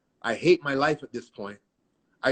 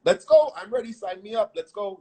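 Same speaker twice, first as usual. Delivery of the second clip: eager excitement; 7 semitones higher, 4 dB louder than usual. Retold or imitated. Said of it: "I'm like".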